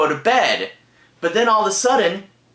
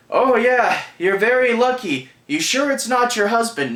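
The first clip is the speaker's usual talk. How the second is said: higher-pitched mock officer humoring a caller; unhelpful police; 6 semitones higher than usual. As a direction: imitate a dismissive cop. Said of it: "just being super unhelpful".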